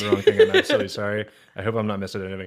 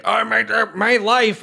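high, loud whine of woe; mock anguished ranter; 13 semitones higher, 6 dB louder, slower than usual. Mocking a comic who complains on stage, high loud wail.

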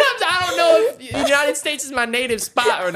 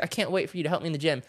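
softer, fast, lower mimicry; mock eager voice; 7 semitones lower, 10 dB quieter, faster than usual. Husband mimics wife's eagerness quietly and fast. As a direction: rattle it off softly, mocking. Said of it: "she's like".

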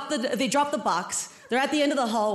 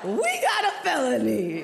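gleeful cop proclaiming a result; excited cop. Retold or imitated. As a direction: announce it like a game show win. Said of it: "He's all excited".

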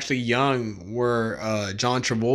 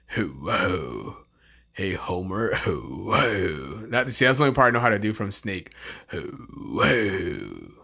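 low, slurred "Hello"; lightly voiced small-time crook; Snake impression; 4 semitones lower, slower than usual. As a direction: sly sneer on each "Hello". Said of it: "Snake from The Simpsons".

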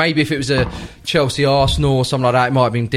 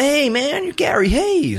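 excited fan greeting; 12 semitones higher, slower than usual. Retold or imitated. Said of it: "Chelsea fans came over and were like".

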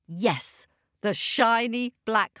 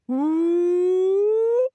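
high loud rising ooh; a swelling magic ooh; 8 semitones higher, 6 dB louder than usual. Acted. Storyteller's magic nut sprouting, a high swelling ooh.

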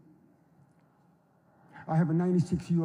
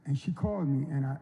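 ordinary delivery

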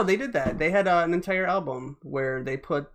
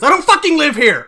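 mock outrage; 10 semitones higher, 12 dB louder than usual.

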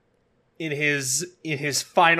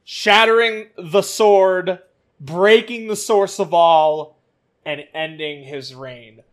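dramatic narration; 3 semitones higher, 6 dB louder than usual. Real, not acted. Dramatic recap.

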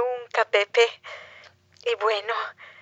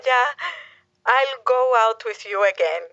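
ordinary delivery

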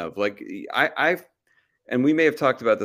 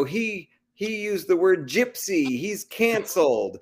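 higher, slow mock-indignant cancel call; mock outrage; 8 semitones higher, slower than usual. Mocking the outraged fringe to the podcast hosts, higher, slow mock outrage. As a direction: ham up a scandalized accuser, higher and slow.

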